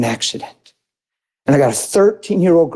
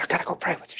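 soft unvoiced whisper, much higher; whispered aside; 13 semitones higher, 14 dB quieter, much faster than usual. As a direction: tell her quietly, almost breathless. Retold line, unvoiced.

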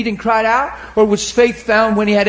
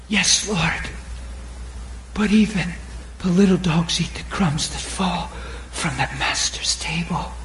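softer, fading to a whisper; humble reply; 6 semitones lower, 8 dB quieter than usual. Acted. The woman answers Jesus humbly, softer until a whisper. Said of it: "she says".

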